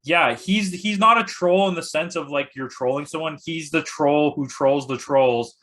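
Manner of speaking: usual speaking voice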